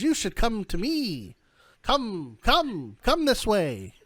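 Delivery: high, beckoning crone voice; old fortune teller. Acted. Old woman urges the travellers over, high crone voice. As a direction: beckon them high, falling on each word.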